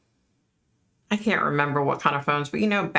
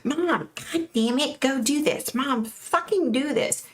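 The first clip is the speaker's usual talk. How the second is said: high, slow mock screaming; 9 semitones higher, slower than usual. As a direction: imitate a bratty tirade at mother, without full volume. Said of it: "And she was screaming".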